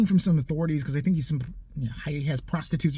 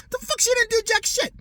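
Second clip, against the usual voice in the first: much higher, raised angry disbelief; outraged rant; 12 semitones higher than usual. Real, not acted. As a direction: vent furiously that she did nothing.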